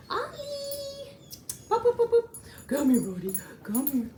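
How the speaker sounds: high, loud coaxing call; calling the puppy. Real, not acted. Trainer calling Ollie back to her, high and loud coaxing. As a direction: sing out to bring the dog running.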